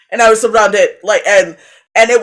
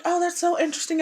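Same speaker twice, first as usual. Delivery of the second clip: higher, much softer, airy fascinated voice; 8 semitones higher, 10 dB quieter, faster than usual. Retold or imitated. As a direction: imitate their oblivious fascination. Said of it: "The people around me are like".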